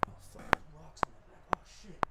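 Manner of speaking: hushed, unvoiced cursing under the breath; real whispered grumble; whispered aside. Real, not acted. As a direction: whisper it to yourself. Sudden aside about rocks on back.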